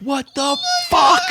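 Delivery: high, stretched shocked shout; retold yell. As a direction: blow up at the kid. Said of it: "Like, I lose my shit".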